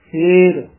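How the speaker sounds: ਫੇਰ said clearly as a single word; connected ending. Shown as a demonstration. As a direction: sound ਫੇਰ out distinctly to its final r. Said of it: "ਯਮਕ ਲਾ ਕੇ ਉਚਾਰਨ ਕਰਨਾ ਹੈ".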